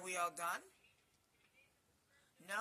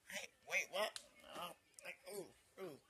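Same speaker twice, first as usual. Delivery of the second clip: ordinary talk, nothing marked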